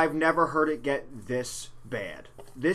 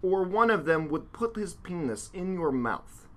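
broken Russian man, deliberate mock accent; sad Russian accent. Host reading a survivor's account as asked, sad Russian accent. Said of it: "say it like a sad, broken Russian man".

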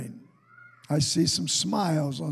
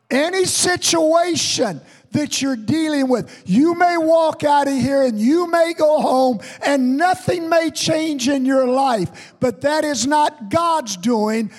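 higher and louder, driving the point home; preaching at full force; 6 semitones higher, 5 dB louder than usual. Acted. Pastor urging his congregation to take responsibility, preaching at full force. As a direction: preach it hard and rising.

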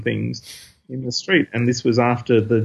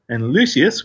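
usual speaking voice

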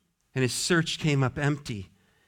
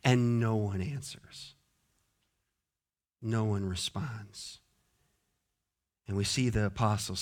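low, much softer, with heavy pauses; hushed, somber preaching; 9 semitones lower, 9 dB quieter than usual. Real, not acted. Preacher describing John weeping as no one answers, hushed and somber. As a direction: let it sink into quiet desolation.

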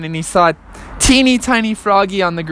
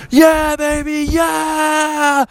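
higher stretched cheer; slow-motion cheer; 11 semitones higher, much slower than usual. Acted.

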